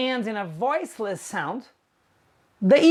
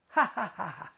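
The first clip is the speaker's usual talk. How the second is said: soft demo ha ha; little breathy laugh to show laugh; mock laugh; 10 dB quieter, much faster than usual. Shown as a demonstration.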